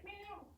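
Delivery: high, soft electronic whine; imitated hearing-aid whine. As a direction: squeal softly like a hearing aid switching to loop.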